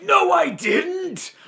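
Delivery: high, slow, shouted denial; indignant shout. Acted. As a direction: yell it back like a frustrated kid. Batman retorting to alien.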